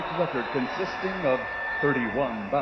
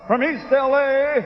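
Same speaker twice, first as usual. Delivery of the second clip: ring announcer; 11 semitones higher, 5 dB louder than usual. Acted. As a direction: drag out each title to build hype. Champion introduction building to a roar.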